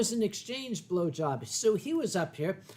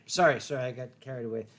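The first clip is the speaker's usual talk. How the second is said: ordinary talk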